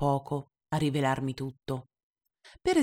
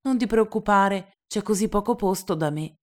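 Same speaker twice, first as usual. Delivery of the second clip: put-on higher, louder earnest boy; little prince, serious again; 5 semitones higher, 5 dB louder than usual. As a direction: reassure like a serious child. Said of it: "di nuovo serio".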